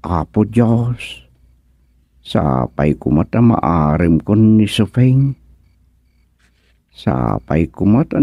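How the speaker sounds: low, murmured plea to God; hushed prayer. Acted. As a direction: pray under your breath, hoping. Acted whispered prayer scene.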